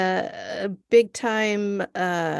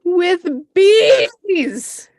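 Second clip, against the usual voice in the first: loud, high burst of disbelief; excited outburst; 9 semitones higher, 7 dB louder than usual. Real, not acted. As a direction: blurt it out, incredulous. Outraged punchline about killer bees.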